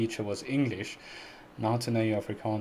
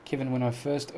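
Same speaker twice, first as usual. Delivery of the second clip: ordinary delivery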